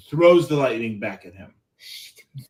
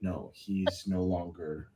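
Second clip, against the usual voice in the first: hushed; 5 semitones lower, 5 dB quieter than usual. Acted. Dramatic hushed explanation.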